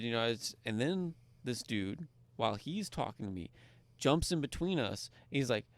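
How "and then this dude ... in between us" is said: ordinary talk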